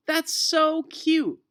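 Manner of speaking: high, slow, gushing teacher voice; thrilled young teacher gushing over the class; delighted. Acted. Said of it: "was delighted".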